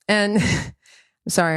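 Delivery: ordinary delivery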